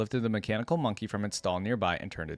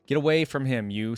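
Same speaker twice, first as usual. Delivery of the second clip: louder, higher command; stern warning; 4 semitones higher, 6 dB louder than usual. A narrator's reading. Watchman to intruder at the cabinet, stern warning. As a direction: order them off, mildly hammed-up.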